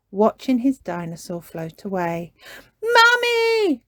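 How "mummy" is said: high, loud call for Mummy; crying out. A narrator's reading. Peppa calls her mother for help, high cry. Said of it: "cried Peppa".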